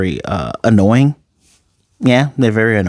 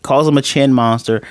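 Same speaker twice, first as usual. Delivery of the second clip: ordinary talk, nothing marked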